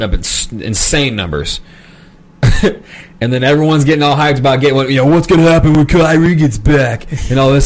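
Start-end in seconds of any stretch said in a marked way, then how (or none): 5.1-7.7 lightly voiced surfer dude; laid-back surfer fan, lightly done. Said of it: "I don't know why surfer voice for the Nets".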